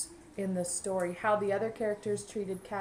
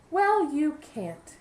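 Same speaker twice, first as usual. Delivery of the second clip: blunt sparrow; 8 semitones higher, 7 dB louder, much slower than usual. Acted. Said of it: "said Sparrow".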